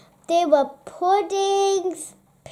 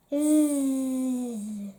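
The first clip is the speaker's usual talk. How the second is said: soft, low sleepy snore; snoring; 4 semitones lower, 4 dB quieter than usual. A narrator's reading. Narrator snoring for the sleeping badger, soft and low. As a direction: breathe out a drowsy zzz. Grumpy Badger fell asleep, dreaming.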